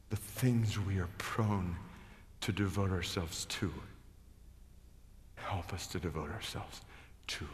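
prayer. Acted. Pastor prays over the congregation, fading to a hush. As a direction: pray over the room, letting it fall to a hush.

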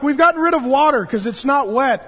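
loud, high incredulous mock quote; mocking absurdity. Mocking the denomination's absurd reasoning, loud and incredulous. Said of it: "That's like".

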